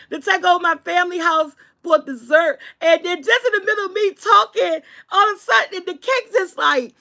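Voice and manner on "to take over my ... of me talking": ordinary talk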